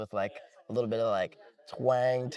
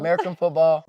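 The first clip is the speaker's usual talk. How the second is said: louder, higher, eager repeat of the topic; excited echo; 4 semitones higher, 8 dB louder than usual. Real, not acted. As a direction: announce it like a fan. Guest echoes the topic excitedly.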